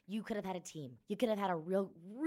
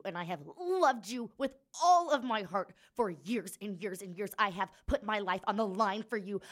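from steady to loud and higher; building anger; 3 semitones higher, 5 dB louder than usual. Acted. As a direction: build it into a furious plea. Griana confronts Kai, building.

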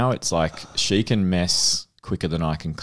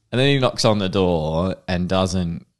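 normal talking voice

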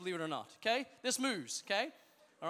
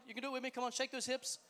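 usual speaking voice

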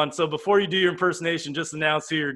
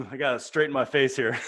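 usual speaking voice

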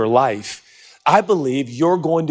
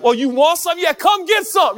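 mock threat; 13 semitones higher than usual. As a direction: bark the challenge like a cocky kid. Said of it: "I was a threatener".

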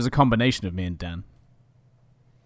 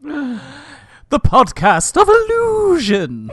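high, drawn-out grand title reveal; theatrical announcement; 12 semitones higher, 5 dB louder, much slower than usual. Pretend. Podcast host unveils the pun title, hammy and drawn out. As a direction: announce it slowly with mock grandeur.

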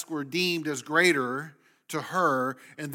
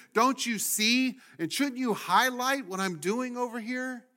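Martha's complaint; 4 semitones higher than usual. Acted. Acting Martha's rising complaint.

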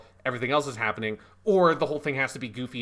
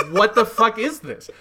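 loud, raised, laughing outburst; laughing disbelief; 7 semitones higher, 7 dB louder than usual. Real, not acted. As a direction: blurt it out through laughter, incredulous.

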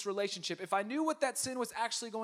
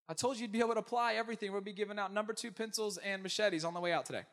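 ordinary talk, nothing marked

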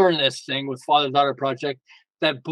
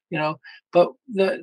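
ordinary talk

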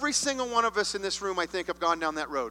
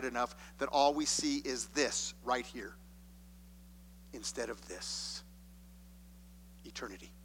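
intimate hush; 7 semitones lower, 9 dB quieter than usual. Real, not acted. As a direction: draw the room in, softly. Preacher drops to an intimate hush.

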